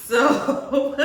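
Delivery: ordinary talk, nothing marked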